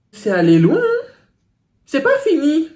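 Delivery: can't believe how far it went; excited exclaim. Real, not acted. Reacting to a viewer's story, loud and incredulous.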